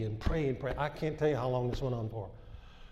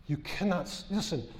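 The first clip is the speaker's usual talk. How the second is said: fervent preaching; 5 semitones higher than usual. Acted. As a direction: preach it to the church with urgency. Preaching warning on speech.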